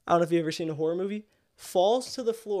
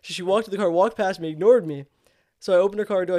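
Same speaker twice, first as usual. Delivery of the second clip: usual speaking voice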